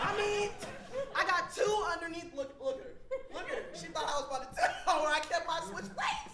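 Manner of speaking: high, loud comic indignation; loud boasting. Acted. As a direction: crow it loudly, scoffing at the doubters. Acted indignant boast to listeners.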